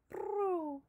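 high rolled prrroo like a pigeon; pigeon coo. A narrator's reading. Pigeons nodding their heads, imitated pigeon coo.

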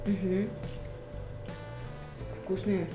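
normal talking voice

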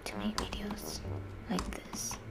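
sudden unvoiced whisper; whispered aside to viewers. Real, not acted. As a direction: drop to a whisper for the end. Drops voice mid-sentence.

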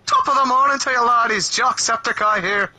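light hammed-up Irish YouTuber greeting; Jacksepticeye Irish impression. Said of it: "am I still Irish?".

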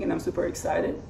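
whispery, worn-out voice; voice giving out. Real, not acted. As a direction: voice almost gone from praying. After staying up all night praying.

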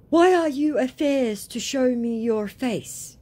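high, loud, slow challenge; mock indignant scammer. Scammer challenging the woman to show herself, mock indignant. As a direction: turn the blame in a high put-on voice. Scammer turning it around, acted out.

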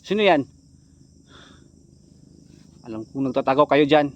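very loud call into hiding places; challenging shout. Real, not acted. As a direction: call them out forcefully. Challenges hidden people. (pasigaw na paghamon)

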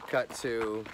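ordinary talk, nothing marked

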